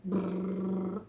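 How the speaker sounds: lip buzz brrrr; lip trill. Shown as a demonstration. Showing a toddler a lip sound, a brrrr buzz. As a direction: blow a brrrr with the lips. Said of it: "things like".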